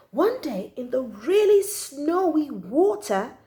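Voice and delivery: usual speaking voice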